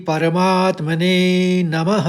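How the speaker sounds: slow, chanted opening prayer; solemn invocation. Acted. Teacher opening the lesson with a slow prayer. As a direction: intone it like a prayer.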